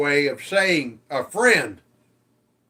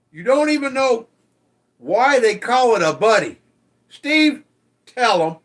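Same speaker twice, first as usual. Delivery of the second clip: exasperated outburst; 5 semitones higher, 6 dB louder than usual. Real, not acted. Exasperated at chat, calling Steve.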